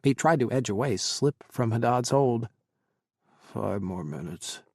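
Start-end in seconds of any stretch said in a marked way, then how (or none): 3.4-4.6 low, slow, soft mumble; drowsy, drawn-out murmur in a low register; sleepy mumble. A narrator's reading. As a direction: mumble it drowsily, half asleep. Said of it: "Haddad mumbled".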